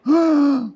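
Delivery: loud high mock gasp; shocked gasp. Shown as a demonstration. Host demonstrating the boy's big gasp. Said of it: "okay, like that".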